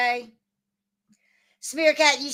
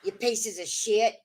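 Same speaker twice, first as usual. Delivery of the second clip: ordinary talk, nothing marked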